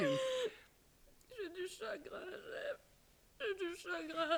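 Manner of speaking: tearful. Acted. Wife confessing her sorrow in tears to her husband. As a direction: let the grief spill out slowly.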